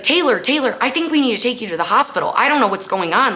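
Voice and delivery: high, hurried, anxious retelling; imitated panic. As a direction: act out a panicked man rushing his words. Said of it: "and he's panicking".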